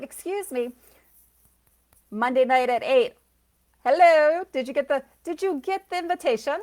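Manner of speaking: hammed-up shout across to the other side; calling to spirits. Psychic calling out loudly to spirits about the invitation. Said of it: "I'm like".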